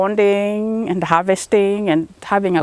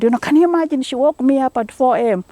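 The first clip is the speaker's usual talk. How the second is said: higher, quicker retold grumble; mock complaint; 4 semitones higher, faster than usual. Man joking to friends about his wife's early wake-up, mock complaint. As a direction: playfully imitate a put-upon husband. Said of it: "making fun of that".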